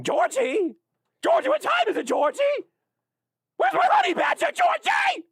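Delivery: shrill creepy clown shriek, very high; Pennywise the Clown. Retold or imitated. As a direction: screech like a demented clown. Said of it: "can I do a Pennywise the Clown impression?".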